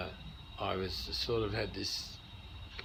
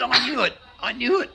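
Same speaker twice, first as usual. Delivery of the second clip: much higher and louder, triumphant squeal; excited exclaim; 13 semitones higher, 12 dB louder than usual. Retold or imitated. American lady guesses he's meeting the Pope, much higher and louder triumphant cry. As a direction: burst out like you guessed the punchline. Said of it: "She said".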